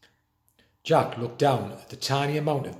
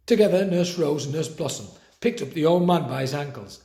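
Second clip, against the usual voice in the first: usual speaking voice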